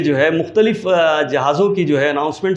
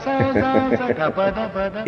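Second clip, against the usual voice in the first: laughing; 6 dB quieter than usual. Real, not acted. Laugh after the joke.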